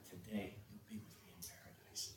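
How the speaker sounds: very soft, near-whispered assurance; tender promise, barely voiced; hushed promise. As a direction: drop right down to a hush. Jesus quoted promising the thief paradise.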